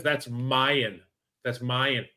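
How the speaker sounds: "Mayan" for mine, in two syllables; Boston pronunciation of "mine". Retold or imitated. Son showing dad's leftover accent, saying mine as "Mayan". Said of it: "It's like a Boston thing".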